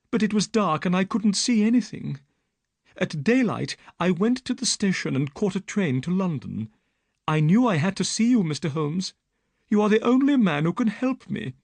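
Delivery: put-on frightened young woman telling her story; Miss Stoner.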